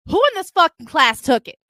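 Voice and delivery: loud, high-pitched demand; indignant shout; angry accusation. Retold or imitated. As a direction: confront the whole room. Confronting the class over theft.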